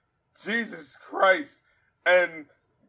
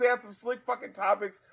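ordinary delivery

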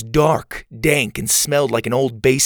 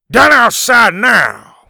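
high, slow, booming gruff yell; gruff shout; 11 semitones higher, 10 dB louder, slower than usual. Acted. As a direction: roar it with marbles in the mouth. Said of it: "the man shouted".

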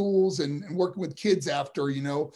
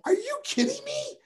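high, squeaky retold disbelief; amazed disbelief. To the organizer about fans lined up, retold, high-pitched disbelief. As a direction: jump way up in pitch in stunned surprise. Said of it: "I go".

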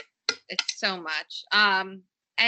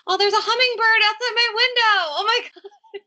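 excited surprise; 12 semitones higher than usual. Real, not acted. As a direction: gasp with delight at a bird outside the window. Spots a hummingbird outside the window.

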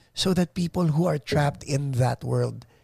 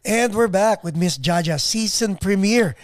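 show host announcing; 7 semitones higher, 4 dB louder than usual. Acted. Host back from break.

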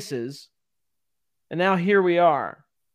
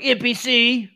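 mock taunt; 5 semitones higher, slower than usual. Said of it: "you're like".

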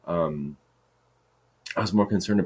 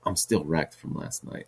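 ordinary talk, nothing marked

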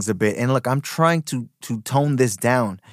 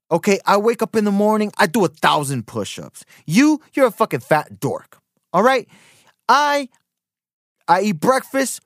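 exaggerated braggart; 5 semitones higher than usual. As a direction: pump yourself up and sneer at the listener. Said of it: "exaggerated form of motivation".

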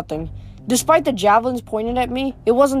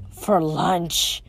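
a low, gruff bear-man growl; 4 semitones lower, 3 dB quieter than usual. A narrator's reading. Bear twin threatening the half-bloods, low growl. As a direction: growl it out like a hungry beast. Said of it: "Agrius growled".

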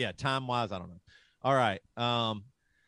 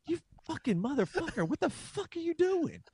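much higher, hammed-up scolding; mock furious rant; 4 dB quieter than usual. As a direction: shriek it like an outraged inner bully. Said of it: "I was like".

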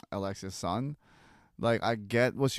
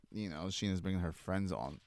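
ordinary talk, nothing marked

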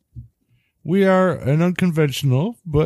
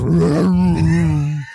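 bear growl; 5 semitones higher, slower than usual. Retold or imitated. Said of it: "her name was".

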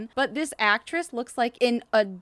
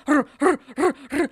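mock horse grunts, louder; horse grunting; 4 semitones higher, 5 dB louder than usual. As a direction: grunt like a galloping horse-girl. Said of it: "galloping like a humanoid hor".

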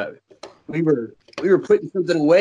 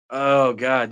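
ordinary talk, nothing marked

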